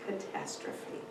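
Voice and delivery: ominous storyteller hush; hushed dramatic whisper. A narrator's reading. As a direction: drop to a breathless whisper on the final word. Dramatic reading of impending disaster.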